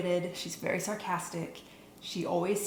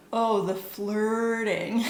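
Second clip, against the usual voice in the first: loud, drawn-out mock exasperated exclamation; exaggerated note reading; 3 semitones higher, 7 dB louder, much slower than usual. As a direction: draw it out very slowly and loudly, hammed-up. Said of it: "one of my notes just says".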